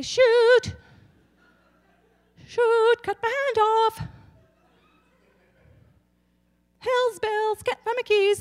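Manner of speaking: high, chirpy mock outburst; impersonating mother. Mother cutting off her hand, chirping mild curses. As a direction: play a prim mom who won't swear. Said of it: "She would say".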